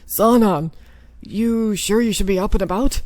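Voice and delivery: firm, caring captain; concerned captain voice. Acted. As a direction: call out to him with concern. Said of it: "messing up on everybody's voice".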